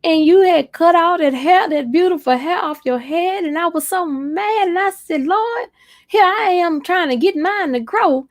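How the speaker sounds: put-on high, fretful older woman; church mother. An older church lady admits she was mad about the cut hair, high and fretful. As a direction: let the elder confess her jealousy in a high voice. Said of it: "She said".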